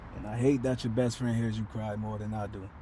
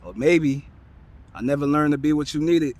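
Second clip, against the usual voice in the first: raised voice, higher and louder; impassioned confession; 4 semitones higher, 7 dB louder than usual. Acted. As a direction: own up to your failings with rising force. Apology poem building to confession.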